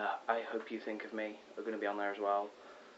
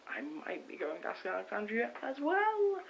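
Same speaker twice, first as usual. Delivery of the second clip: buzzing with excitement; 11 semitones higher, 3 dB louder than usual. Real, not acted. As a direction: pitch climbing to a high squeal. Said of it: "I am kind of buzzing".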